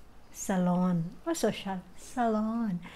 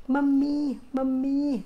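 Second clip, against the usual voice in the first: Mami, mami called louder and higher with force; stressed call; 5 semitones higher, 5 dB louder, slower than usual. Shown as a demonstration. Vlogger showing how India calls Mami, forceful call. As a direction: hit each Mami hard, like calling out. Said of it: "Talagang may diin".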